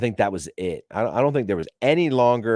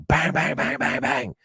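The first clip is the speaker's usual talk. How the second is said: mock window banging; 9 semitones higher than usual. Shown as a demonstration. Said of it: "You rap on that fucking window".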